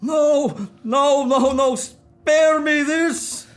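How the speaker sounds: high, slow pleading no; slow, rising cry of anguish; anguished plea. Acted. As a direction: beg to be spared the memory. Begs not to see her.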